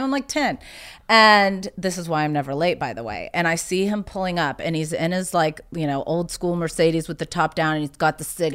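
ordinary talk

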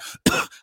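wet hacking cough; real sick cough; chesty cough. Real, not acted. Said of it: "You hear my chest?".

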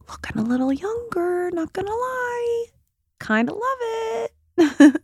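very high, squeaky little voice; playful self-admiration. Real, not acted. Playfully admiring her own results.

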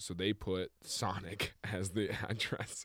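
ordinary delivery

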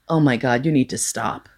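low, subdued, exasperated warning; fed-up plea. Retold or imitated. Teller begging a date to stop critiquing the books, low and fed up. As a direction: drop the voice, at the end of patience.

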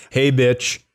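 normal talking voice

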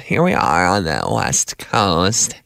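put-on low, drawn-out California accent; Kardashian. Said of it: "it's like the Ka-, the Kardashian thing".

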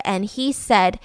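ordinary delivery

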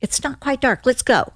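breezy, offhand retelling; mock casual. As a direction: imitate a husband casually suggesting an outing. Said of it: "He's so casual".

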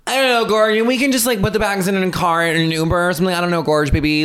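high, fast, run-together valley voice; airy LA girl. Retold or imitated. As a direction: gush it all as one word. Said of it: "saying everything as one word".

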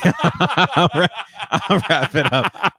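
slow, laughing through the words; laughing wrap-up. Real, not acted. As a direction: wrap it up while cracking up.

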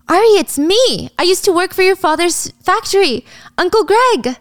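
high, exaggerated happy recognition; hammed-up joyful reunion voice; delighted greeting. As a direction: call out eagerly to an old acquaintance. Old acquaintance greets Ari joyfully.

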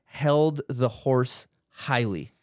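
Harry Hagen held the horse highly, leído muy despacio; slow test sentence. Shown as a demonstration. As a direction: cada h marcada con calma.

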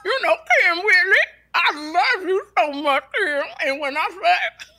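exaggerated diva voice, mouth pulled to one side. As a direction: swoop high and mangle the vowels like a caricatured singer. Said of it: "when [name] talks like this".

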